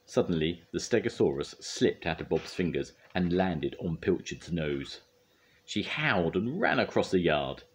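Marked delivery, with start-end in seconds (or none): none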